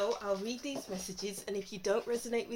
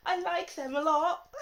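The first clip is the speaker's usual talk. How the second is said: high, louder gushing through laughter; mock gushing; 7 semitones higher, 5 dB louder than usual. Imitating people fooled by someone iffy, laughing mock gushing. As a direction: gush it in mockery, half laughing.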